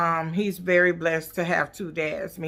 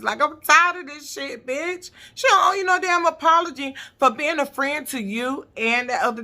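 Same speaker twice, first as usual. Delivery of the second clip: exasperated rant; 5 semitones higher than usual. Real, not acted. Fed-up rant at Candace.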